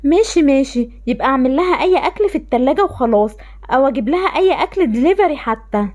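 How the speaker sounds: ordinary delivery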